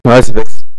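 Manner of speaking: saturated blown-out mic; clipping distorted mic. Real, not acted. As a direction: voice clipping through a maxed mic. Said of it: "c'est saturation de micro". (micro saturé qui grésille)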